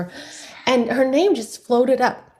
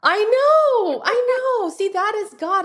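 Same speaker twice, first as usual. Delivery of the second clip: high-pitched, drawn-out exclamation; excited agreement; 12 semitones higher than usual. Real, not acted. Guest thrilled that co-host praises Emma, excited agreement. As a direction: agree wholeheartedly, voice soaring up high.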